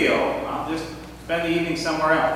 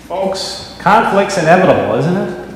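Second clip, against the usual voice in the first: loud, emphatic pulpit delivery with bursts of force; Sunday sermon, firm and loud; sermon preaching; 5 dB louder than usual. Real, not acted. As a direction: preach it to the congregation, pressing each point. Pastor preaches after the skit.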